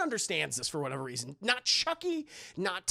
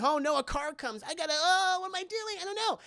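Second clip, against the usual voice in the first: mock panic; 10 semitones higher than usual. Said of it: "walking over it and".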